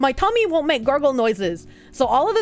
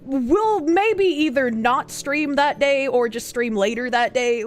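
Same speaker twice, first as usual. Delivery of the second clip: ordinary delivery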